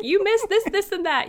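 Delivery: high-pitched imitation of an outraged expert; mock screaming. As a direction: playfully squeal the complaint. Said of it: "you're screaming at us".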